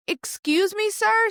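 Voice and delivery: a high, stunned "excuse me?"; incredulous. Retold or imitated. Patient stunned by the doctor's blunt news, high and incredulous.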